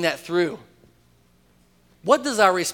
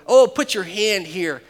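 mock indignant; 4 semitones higher, 7 dB louder, slower than usual. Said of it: "heard this text read like".

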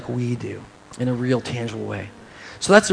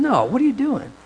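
high-pitched, incredulous correction; mild mock rebuke; 10 semitones higher than usual. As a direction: pull them up short, voice raised in pitch. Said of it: "then Jesus corrected them".

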